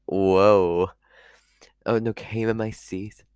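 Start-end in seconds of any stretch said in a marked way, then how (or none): 0.1-0.9 loud, drawn-out scandalised whoa; shocked whoa. Retold or imitated. Reading the tweet, a loud shocked whoa. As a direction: gasp at the dirty tweet. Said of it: "I was like".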